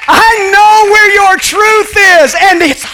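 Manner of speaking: high, loud triumphant call; shouting. Acted. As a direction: call out like a herald. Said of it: "Christ anointing shouting out".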